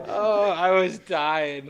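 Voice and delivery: ordinary talk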